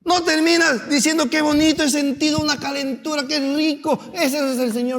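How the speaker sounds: high, loud, impassioned sermon build; preaching at full force. Acted. Pastor rousing the congregation, impassioned loud preaching. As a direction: preach it, rising and pressing the congregation.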